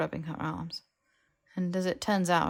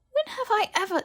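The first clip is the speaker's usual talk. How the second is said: high, louder indignant outburst; Harry protesting Hermione's accusation, high and indignant; 5 dB louder than usual. Acted. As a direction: fire back, offended.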